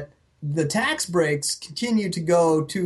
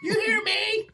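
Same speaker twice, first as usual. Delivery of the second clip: laughing high squeak. Pretend. Mock panicked call, laughing.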